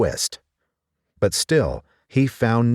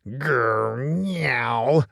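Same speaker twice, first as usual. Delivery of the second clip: vexed cat growl; 4 semitones higher than usual. Acted. Simpkin bouncing in out of the snow, angry cat growl. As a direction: grumble like a cross cat. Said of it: "with an angry".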